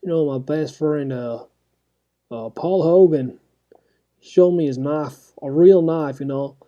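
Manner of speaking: a cheeky fake Aussie, half-joking; Australian accent. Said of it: "I started speaking with an, an Australian accent".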